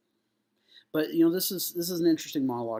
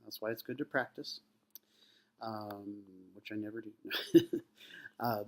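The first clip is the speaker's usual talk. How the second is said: ordinary delivery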